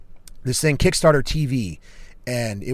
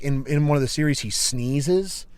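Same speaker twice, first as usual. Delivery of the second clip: ordinary talk, nothing marked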